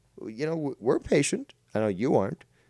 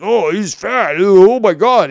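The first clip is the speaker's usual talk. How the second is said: loud, high mock horror; mocking crowd; 7 semitones higher, 10 dB louder than usual. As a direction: parody everyone's shocked gasping. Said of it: "everyone's like".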